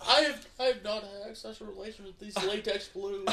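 mock presidential denial, quietly; president impression. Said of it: "you gotta do it in president's go".

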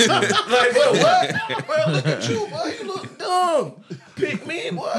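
high, loud and cracking up; laughing mockery. Real, not acted. Clowning a friend among the guys, loud laughing mockery. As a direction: roast him through big laughter. Roasting someone amid group laughter.

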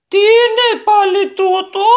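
put-on high, loud, slow startled old woman; old lady's cry. A narrator's reading. Old lady sees the goblin, high frightened cry. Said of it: "φώναξε μια ηλικιωμένη κυρία".

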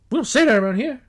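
high, loud mock Southern scolding; imitated Southern drawl, indignant. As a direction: mimic an offended backwoods Southern man. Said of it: "he sounded like".

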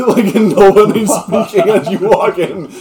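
high, loud, laughing narration; talking through laughter. Real, not acted. Game master describes silent hall to players, laughing. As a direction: crack up while telling it.

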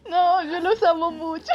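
whimpered adoration played for laughs; mock tearful affection. Fan consoled by her idols, mock tearful affection. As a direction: imitate a weepy fan being comforted. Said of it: "ahí me empezaron a consentir".

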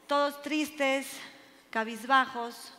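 slow, subdued and soft; downcast. A narrator's reading. Telling how the doctors gave up, downcast. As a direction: let it sink, slow and defeated.

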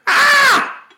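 sudden scream to scare; startling boo. Acted. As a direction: yell out to startle listeners. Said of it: "Did I scare you?".

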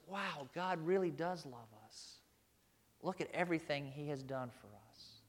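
hushed, wondering aside; soft, amazed inner voice; awed realization. Pretend. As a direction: let the wonder settle in quietly. Realizing God's love.

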